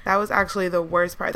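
ordinary delivery

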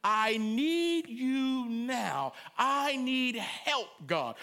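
very slow, tearful plea; crying plea. Acted. Preacher voicing a needy prayer to God. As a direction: beg slowly, near tears. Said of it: "stretched out hands speak of neediness".

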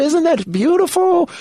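high slow Michael impression. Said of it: "he turns to his assistant".

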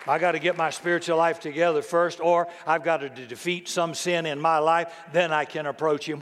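ordinary delivery

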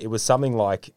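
usual speaking voice

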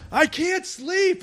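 high, drawn-out mock wail; stretched, high-pitched caricature of a complaining woman; mimicked heckler woman. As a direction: imitate her in a high, slow whine. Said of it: "And she just goes".

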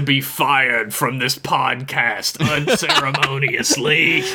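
slow, ceremonial sentencing; grand mock-judicial proclamation; mock tribunal judge. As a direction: intone the verdict word by word.

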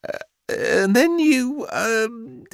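high, hesitant, drawn-out fumbling; slow, high-pitched stammering mother; faltering mother. A narrator's reading. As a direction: lose your thread nervously. Mother falters before unmoved son.

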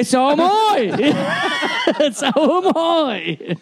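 high, drawn-out jokey exclamation; hammed-up retort. Host joking about body hair, high drawn-out retort. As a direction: stretch it out high with a laugh.

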